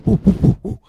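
high, loud repeated woofs; loud mock barking; imitated dog bark. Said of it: "then you hear this woo".

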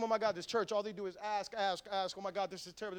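quiet, low muttered complaint; grumbling. Acted. Churchgoer fed up with being asked, muttered grumbling. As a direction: grumble softly under the breath. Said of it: "This, grumbling and arguing".

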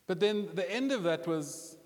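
ordinary talk

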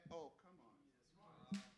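ordinary talk

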